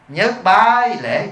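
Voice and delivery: slow, drawn-out funeral rite command; mock ceremonial call. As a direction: call out the first bow like an officiant. Acts out funeral bowing command. (giọng xướng lễ kéo dài)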